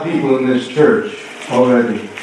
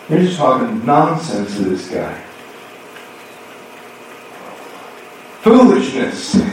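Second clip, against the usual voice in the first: mock scoff. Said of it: "they'd have said".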